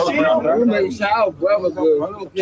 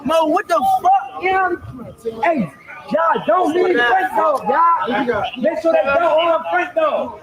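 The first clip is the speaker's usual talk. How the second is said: higher urgent shout; shouting to friends; 8 semitones higher than usual. Real, not acted. Bystander yells to friends to stay with Frank, urgent shout. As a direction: call out across the street. Calling out to crowd.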